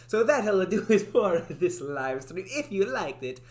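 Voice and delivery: cartoon Italian accent, playing it up; mock Italian accent outro. Shown as a demonstration. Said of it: "go a full Italian and roll my R's".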